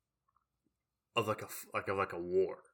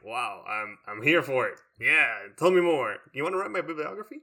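louder, higher mock eager reply; mock enthusiasm; 3 semitones higher, 7 dB louder than usual. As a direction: gush over it, playfully. Sarcastic reply to the guide.

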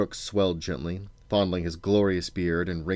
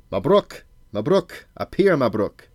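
loud, higher summoning call; crying out; 6 semitones higher, 6 dB louder than usual. A narrator's reading. King summons his royal wizard, crying out. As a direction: call out sharply for a servant. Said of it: "crying out".

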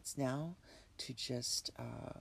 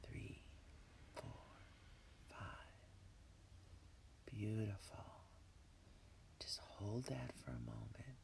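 breathy whisper, calm and unhurried; guided meditation; 6 dB quieter than usual. Acted. Leads a relaxation meditation.

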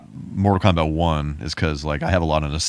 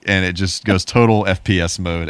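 usual speaking voice

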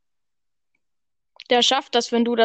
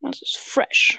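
whispering; slower than usual. Real, not acted. Whispered aside.

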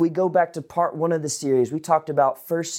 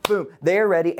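ordinary delivery

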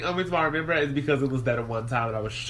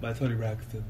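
deep lower register; 5 semitones lower, 6 dB quieter than usual. Pretend. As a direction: drop into a low baritone. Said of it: "exploring your lower register".

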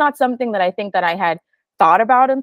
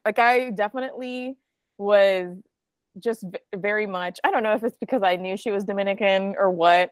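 ordinary delivery

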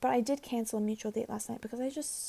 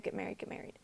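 mocking whisper; 4 semitones lower, 12 dB quieter, faster than usual. Mocking the campus culture.

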